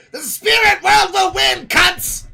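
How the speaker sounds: high, loud mock rant; mock ranting lady. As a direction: scream it like the woman in the clip. Mimicking the ranting woman's clips.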